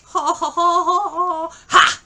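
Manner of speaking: loud, high rapid-fire hoots capped by a sharp "Ha!"; hammed-up fight-scene whoops; mock kung fu cries. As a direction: mimic martial-arts fight noises at full voice. Mock martial-arts cries.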